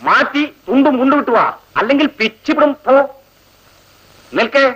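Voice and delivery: high, sneering mock official; mock minister. Seniors ragging juniors, high sneering mock authority. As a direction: lord it over them with a sneer. Mocking ragging of new girls.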